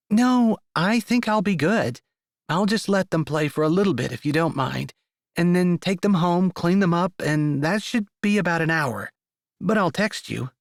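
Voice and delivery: a lightly voiced young mother; mother character voice. A narrator's reading. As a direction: speak as the practical mom. Annie's voice again.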